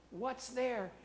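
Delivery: ordinary talk, nothing marked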